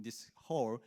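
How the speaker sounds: usual speaking voice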